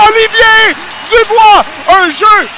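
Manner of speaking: loud, high, stretched-out goal shout; excited sports commentary. Real, not acted. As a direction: scream the goal call to the crowd. Goal call in play-by-play. (commentaire de but survolté)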